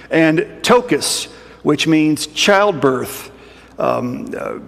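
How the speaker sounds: normal talking voice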